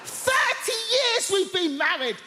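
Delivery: normal talking voice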